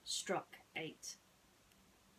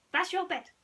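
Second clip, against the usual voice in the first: much higher, louder little mouse; proud mouse; 11 semitones higher, 11 dB louder than usual. Acted. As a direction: point it out proudly to a friend. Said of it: "he said".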